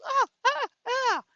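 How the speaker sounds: mock screams as she staggers; faked shrieks. Wife fakes screams while falling out the door, high mock shrieks. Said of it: "she starts going like this".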